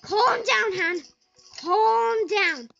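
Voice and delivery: real agitation; loud self-calming. Real, not acted. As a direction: still panicked while telling herself to calm down. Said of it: "just need to calm down".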